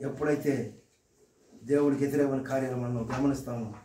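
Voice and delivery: hushed heart-to-heart sermon. Acted. Preacher turns quiet and searching.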